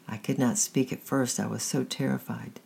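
ordinary delivery

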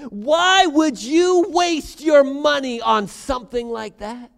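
high, loud, angry rebuke; outraged diner shouting at the woman; harsh scolding. Acted. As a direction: scold her like an outraged guest. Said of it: "began to speak harshly".

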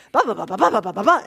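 loud, rapid babble of an angry driver; mock Italian yelling. Taxi driver shouting at the old man, loud mock babble. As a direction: rattle it off like a furious cabbie. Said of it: "this guy's like ... in Italian".

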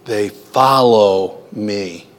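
slow, leaning on "follow"; slow emphatic reading. Shown as a demonstration. Preacher reciting the verse to his congregation, slow and stressed. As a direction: draw each word out and land hard on "follow". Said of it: "and what?".

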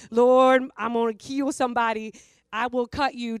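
a loud, hammed-up cry to the Lord, then a threat; mock angry prayer. Preacher acts out a carnal prayer to the congregation, mock angry. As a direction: play the furious praying mother half in jest.

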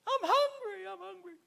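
high wailing plea; hammed-up high whimper, fading at the end; crying out hungry. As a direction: wail for food like a pitiful beggar. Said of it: "he's crying out".

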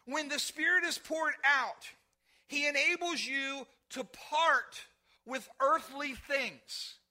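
loud, slow climactic preaching; full-force preaching. Acted. Preacher urging the church to let go, loud preaching. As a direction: press the point home loudly.

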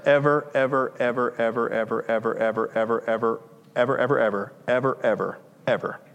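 "ever, ever" repeated, fading down gradually; trailing repetition. Real, not acted.

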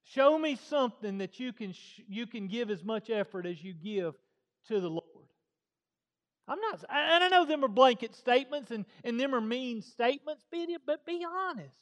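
loud, high preaching shout. Real, not acted. Preacher challenging the congregation, shouting. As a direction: hammer the point home at full volume.

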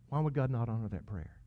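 hushed rhetorical question; preacher asking the congregation softly; whispered question. Real, not acted. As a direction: lean in and whisper it.

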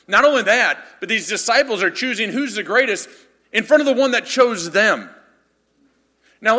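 loud, high, driving sermon voice; full-force preaching. Acted. Pastor driving home the disciples' pride, loud preaching. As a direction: preach it to the back row.

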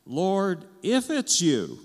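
"if" picked out in a high, loud plea; stress on "if". Acted. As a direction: call it out loudly, leaning on "if". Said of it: "Notice if".

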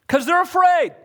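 sudden loud high burst; emphatic shout. Acted. Preacher shouting to the congregation, loud and high. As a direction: shout the reason out.

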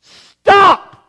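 desperate father yells at his son; yelled. Retold or imitated. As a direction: shout to stop a child running into traffic. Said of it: "he just yelled out".

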